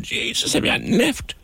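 breathy start leaping up high; mock panic, pitch shooting up at the end; flustered last-minute customer. Radio host imitating fellas running in late, mock panicked.

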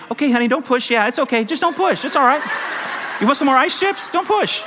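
high-pitched, helpless reassurance; clueless husband. Retold or imitated. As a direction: play a hapless husband trying to soothe, voice jumping up. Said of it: "and me, not knowing anything".

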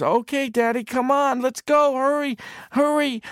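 put-on high, loud, urgent child; high hurrying kid voice; eager little boy. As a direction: tug dad along, impatient. Boy urges father to beach.

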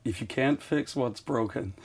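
slow, soft, badly faked American accent; attempted American accent. Shown as a demonstration. Brit copying a fed sentence, shaky attempt at American accent. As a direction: try a careful, labored American accent. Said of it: "Say that with an American accent".

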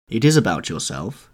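plain reading voice

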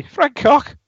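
very high squeaky mock voice; high-pitched repeat. Podcast host echoing the joke, very high squeaky voice. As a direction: squeak the punchline back. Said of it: "in his high-pitched accent".

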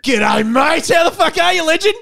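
loud, high-pitched shouted g'day; big rowdy hello; hearty greeting. Real, not acted.

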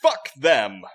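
put-on loud young woman's voice. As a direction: shout it as a brash young woman. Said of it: "came a young female-sounding voice".

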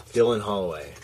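ordinary talk, nothing marked

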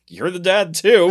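laughing retort. Retold or imitated. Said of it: "She goes".

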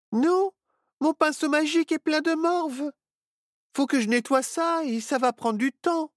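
a witch complaining about her dirty brush; young witch Myrtille. Acted.

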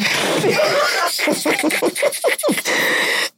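high airy mock giggle; a coy giggle after the boast. Mocking humblebragging women.

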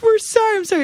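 high-pitched hurried apology; imitated pleading friends; mock apologetic friends. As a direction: squeeze out a panicked sorry, voice way up. Friends apologise in retold fight.

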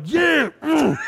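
high mock macho grunt, shouted; hammed-up macho yell; aggressive grunting. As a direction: pump yourself up like a wannabe tough guy. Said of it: "slapping the backboard like".

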